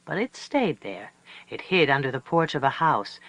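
plain reading voice

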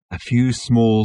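ordinary delivery

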